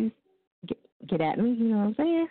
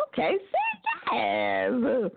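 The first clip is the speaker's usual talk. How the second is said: very slow, coaxing prompt; 5 semitones higher, much slower than usual. Real, not acted. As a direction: draw it out to coax the caller into saying yes. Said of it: "coaching the caller to answer".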